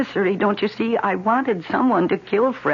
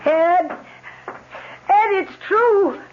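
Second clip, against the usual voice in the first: loud, much higher shout of joy; excited call; 12 semitones higher, 4 dB louder, much slower than usual. Acted. Wife calling out to her husband, overjoyed shout. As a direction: call him in with the big news.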